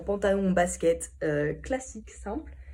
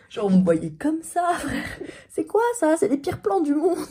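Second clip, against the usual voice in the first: much higher and louder, laughing in disbelief; exasperated outburst; 9 semitones higher, 8 dB louder, slower than usual. Real, not acted. Vlogger mocking her own terrible camera work, loud laughing squeal. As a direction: squeal at how bad the shots are. Reacting to her bad footage.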